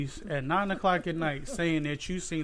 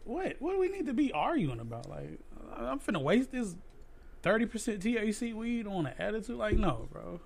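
incredulous; 8 semitones higher than usual. Real, not acted. High-pitched incredulous rant.

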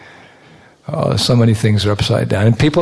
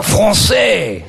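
put-on proud French accent; 9 semitones higher than usual. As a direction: announce français proudly. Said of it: "I tried to speak French really good".

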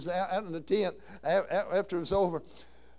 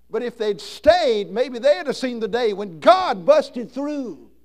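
full-force preaching; 4 semitones higher, 7 dB louder than usual. Acted. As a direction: build it to a fervent climax. Preaching climax.

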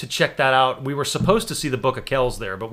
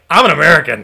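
louder, declared with swagger; proud shout; 3 semitones higher, 10 dB louder than usual. Retold or imitated. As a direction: shout it like it settles everything. Defiant retort to tour guide.

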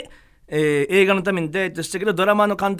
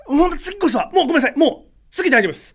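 high, pleading re-enacted apology; flustered apology; 7 semitones higher than usual. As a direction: apologize frantically to the whole crew. Retold apology after repeated takes. (必死に謝る再現の声)

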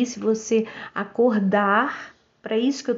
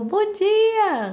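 bright, much higher sing-song good morning; cheery greeting; 10 semitones higher than usual. Retold or imitated. Neighbour greeting her on the street, cheery and high. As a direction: chirp it out like a happy neighbour. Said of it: "a pessoa".

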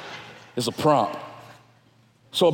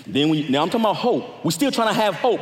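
ordinary delivery

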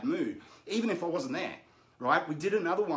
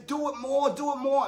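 higher and louder, egging her on; insistent urging; 10 semitones higher, 6 dB louder than usual. Retold or imitated. Dad pushes his daughter for more emotion, loud and high. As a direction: chant it to push her further. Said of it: "And I'd say".

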